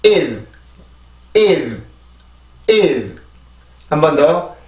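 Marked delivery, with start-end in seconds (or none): 0.0-3.2 "일" with the final consonant attached after the vowel; stressed "일" closing on its end sound; ending sound added. Shown as a demonstration. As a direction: drill "일" as separate falling beats. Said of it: "이게 끝으로 가죠".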